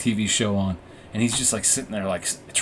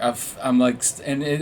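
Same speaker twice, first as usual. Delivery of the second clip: ordinary talk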